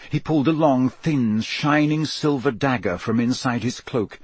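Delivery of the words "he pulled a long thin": ordinary delivery, nothing marked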